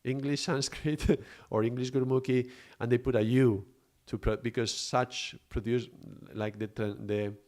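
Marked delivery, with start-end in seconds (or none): none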